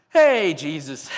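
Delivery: loud, drawn-out "Hey"; calling out. Acted. Disciples interrupting Jesus in the middle of nowhere, calling out. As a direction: call out slowly to get attention.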